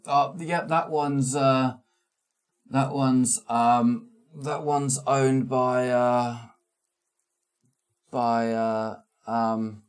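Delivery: guide voice. Acted. GM voices guide pointing out buildings.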